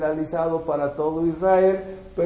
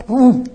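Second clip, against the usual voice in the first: sharp, louder burst; loud pum; 2 semitones higher, 8 dB louder than usual. Retold or imitated. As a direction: punch it out like a bang. Sudden burst of anger.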